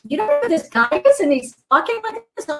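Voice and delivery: shocked he was a stranger; astonished manager. Retold or imitated. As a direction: restaurant manager amazed she doesn't know her date. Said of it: "He goes".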